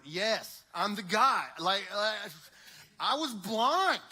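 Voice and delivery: loud, exasperated insistence; exasperated insisting. Acted. Blind man fed up with the questions, loud exasperated insisting. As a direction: shout it, fed up with being asked.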